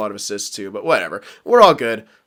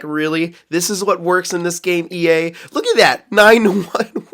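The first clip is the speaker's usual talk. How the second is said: rising, louder disbelief at the game; incredulous rant aimed at EA; half-laughing, pitched-up complaint to EA; exasperated mock outburst; 6 semitones higher, 5 dB louder than usual. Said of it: "I'm like".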